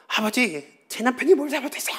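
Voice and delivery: high, fast defiant daughter's protest. Retold or imitated. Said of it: "이러면 이제 파탄 나는 거야". (아버지에게 대드는 딸, 높고 빠른 목소리)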